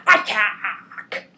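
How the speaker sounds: a loud battle order; loud attack order. Acted. Villain ordering his men, loud shout. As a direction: bark the command to charge.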